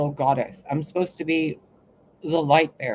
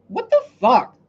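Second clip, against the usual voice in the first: loud, high what the fuck; incredulous exclamation; loud disbelief; 8 semitones higher, 8 dB louder than usual. Real, not acted. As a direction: blurt it in stunned disbelief. Exclaims at her being biblical.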